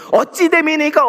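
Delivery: a worshipper protesting "어찌 됨이니까"; complaining question. Retold or imitated. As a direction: throw "어찌 됨이니까" back at God as a complaint. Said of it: "어찌 됨이니까 일어나고 있는".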